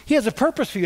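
ordinary talk

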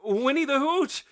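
very high, loud horrified cry; high, loud shriek of horror; terrified wail. A narrator's reading. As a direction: cry out the name in high-pitched terror. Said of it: "look of terror spill across".

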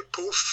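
ett lätt, högt härmat raketljud; säg puff som en raket som fräser iväg. Retold or imitated. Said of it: "handlysraket fräser iväg och säger". (ljust härmat puff från en handlysraket)

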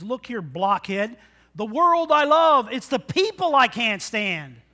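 hammed-up bossy yell at a blockhead; Lucy yelling. Lucy snaps back at Charlie Brown with a mock loud yell. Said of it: "And then she yells at him".

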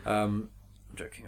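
usual speaking voice

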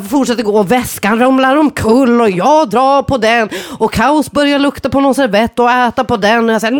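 usual speaking voice

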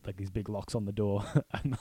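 usual speaking voice

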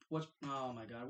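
ordinary talk, nothing marked

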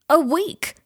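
loud, high startled question. A narrator's reading. Patient learns how much school son missed, startled. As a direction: burst out in shock.

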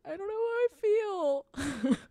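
high, slow, small confused voice; helpless younger self. Retold or imitated. Retelling to a friend how she answered, high and unsure. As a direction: play her younger self, lost and unsure. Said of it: "And I was like".

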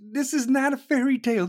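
ordinary delivery

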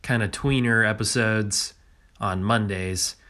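usual speaking voice

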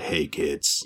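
low, gruff put-on voice; hammed-up low tough guy; tough biker voice. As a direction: growl it softly like a menacing biker. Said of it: "he talks like this".

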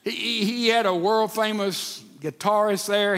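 ordinary talk, nothing marked

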